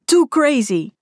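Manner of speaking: high-pitched whooping cheer; excited holler. A narrator's reading. Tobias cheers on a skate jump, excited holler. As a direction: cry it out, pitch jumping up. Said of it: "brulde Tobias achter me".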